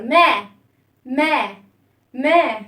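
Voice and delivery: "ما" with the mouth opened wide; open vowel. Shown as a demonstration. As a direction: open your mouth fully on each "ما". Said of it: "افتحوا فمكم يا ولاد أكتر".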